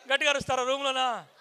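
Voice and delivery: normal talking voice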